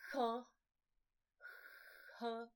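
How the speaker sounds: 呵 as a puff of air opening into uh; aspirated h. Shown as a demonstration. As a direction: push air through 呵, each one on its own. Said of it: "好像有气音".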